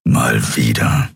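slow, low grumble; grumbling giant. Acted. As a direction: grumble it, unconvinced. (langsames, tiefes Brummen)

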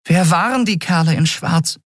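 high, worried fairy question; a tiny girl's voice, anxious; small fairy voice. A narrator's reading. Nelen asks Keru about the unconscious captain, high and worried.

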